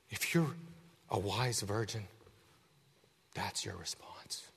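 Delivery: slow hushed whisper. Real, not acted. Preacher confiding to the congregation, hushed whisper. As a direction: whisper it close and intimate.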